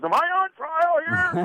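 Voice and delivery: high mock-indignant protest; defensive boss. Boss quizzed about the chart, high-pitched mock indignation.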